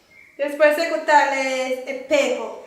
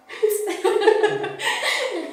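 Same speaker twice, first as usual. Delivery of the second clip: laugh; 7 semitones higher than usual. Real, not acted. Laughs at the word.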